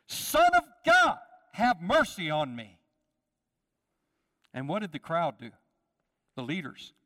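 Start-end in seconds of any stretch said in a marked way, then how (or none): 0.1-2.8 high, loud cry that settles on mercy on me; desperate shout. Acted. Blind man calls to Jesus from the back, shouting. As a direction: call out over the crowd, pleading. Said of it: "He shouts".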